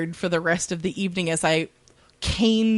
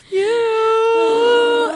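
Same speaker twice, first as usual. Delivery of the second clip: touched cry; 13 semitones higher, 7 dB louder than usual. Real, not acted. Touched by her girlfriend.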